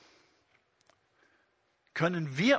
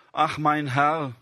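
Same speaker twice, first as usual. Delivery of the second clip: a slow, louder sigh of a doubting Gideon; lightly voiced Gideon, sighing ach at length; Gideon's lament; 5 dB louder, slower than usual. Preacher voicing Gideon answering the angel, mock lament. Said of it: "erwidert Gideon".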